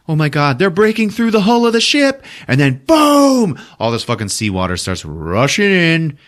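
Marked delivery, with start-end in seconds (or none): 0.1-2.2 rising high-pitched alarm; mock panic. Characters panic as hull breaks. 2.9-3.6 high, loud, drawn-out boom; explosive boom. Retold or imitated. Retold explosion as hull breaks.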